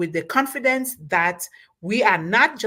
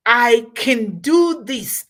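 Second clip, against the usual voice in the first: loud, slow, determined declaration; can-do attitude; 3 semitones higher, 6 dB louder, slower than usual. Acted. Speaker showing how to approach a task, determined can-do. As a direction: stretch it out with confident force.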